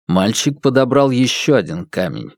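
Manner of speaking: ordinary delivery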